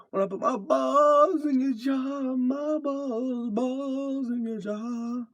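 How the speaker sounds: mocking squeaky voice. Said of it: "I love every time where he's like".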